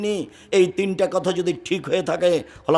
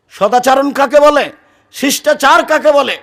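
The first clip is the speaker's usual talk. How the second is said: rhetorical thunder; 7 semitones higher, 9 dB louder than usual. Acted. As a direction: boom the question at the crowd. Preacher asks rhetorical question. (উচ্চস্বরে ধীর প্রশ্ন)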